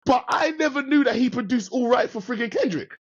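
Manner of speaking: high, amazed disbelief; can't believe it, pitch way up; excited. Real, not acted.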